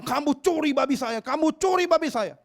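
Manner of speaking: high, accusing repeat; angry father confronting the buyer. Retold or imitated.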